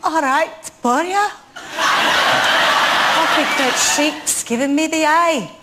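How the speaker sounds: slow sing-song Welsh lilt, laughing through it; Welsh accent. Acted. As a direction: repeat a Welsh phrase heard in headphones. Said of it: "what accent do you think [name] was doing there".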